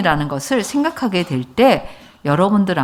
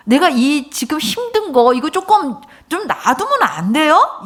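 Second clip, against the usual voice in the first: pleading; 7 semitones higher than usual. Retold or imitated. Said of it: "이런 마음인 거야".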